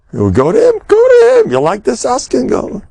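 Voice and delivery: hammed-up, high-pitched challenge; mock incredulous challenge. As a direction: pitch leaping up into a mock outcry. Retold incredulous challenge about the askan.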